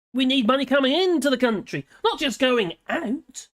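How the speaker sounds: declaimed, pointed script reading. Acted. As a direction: make the argument like a presenter reading a column. Reads out a prepared script.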